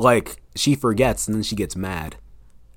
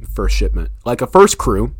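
ordinary talk

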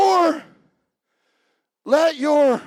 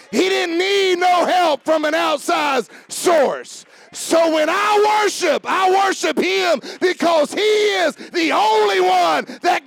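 full-force preaching; 7 semitones higher than usual. Real, not acted. Preacher shouting his praise of Jesus to the congregation. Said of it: "I feel preachy already".

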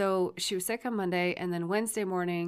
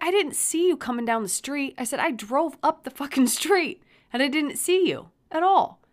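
higher, raised-voice retold defence; exasperated arguing; 7 semitones higher, 5 dB louder than usual. Parent defending the drive to the son, exasperated. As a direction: insist it back, getting louder.

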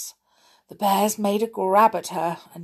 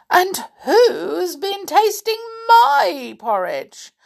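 high, loud, slow indignant bear; Mother Bear voice; 12 semitones higher, 6 dB louder than usual. A narrator's reading. Mother Bear finding her porridge tasted, indignant. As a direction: raise the voice high in protest. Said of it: "said Mother Bear".

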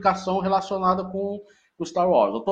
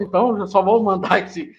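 ordinary talk

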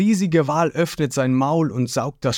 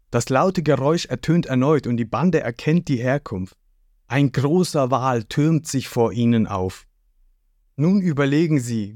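usual speaking voice

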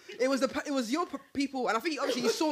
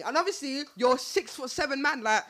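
normal talking voice

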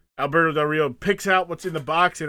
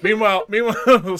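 laughing; 5 semitones higher, 7 dB louder than usual. Real, not acted. Laughing while restarting.

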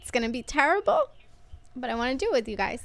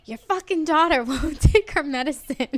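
higher, louder mock-furious dad, laughing through; angry dad; 5 semitones higher, 6 dB louder than usual. Dad calling mom to complain, mock angry. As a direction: complain like an exasperated father on the phone. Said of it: "And he's like".

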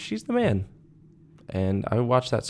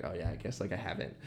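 much softer, sheepish mumble; quiet mumbled quote; 3 semitones lower, 12 dB quieter than usual. Retold or imitated. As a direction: mumble it like an embarrassed friend. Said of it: "And she's like".

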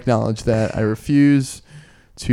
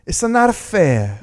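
whiny; 8 semitones higher, much slower than usual. Pretend. Mock whiny complaint.

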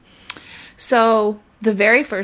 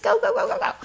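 excited rush; much faster than usual. Retold or imitated. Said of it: "I start talking really fast".

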